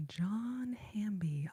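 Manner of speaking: ordinary delivery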